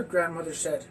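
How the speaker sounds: plain reading voice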